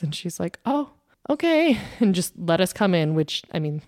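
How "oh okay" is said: taken aback. Retold or imitated. Screener gives in.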